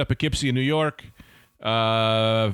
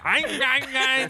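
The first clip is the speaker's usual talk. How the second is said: imitating alien voice; 12 semitones higher, slower than usual. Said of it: "talking like my racist uncle".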